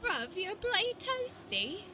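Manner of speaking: lightly put-on British accent, bloody rising; demo British insult. Shown as a demonstration. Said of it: "Like that".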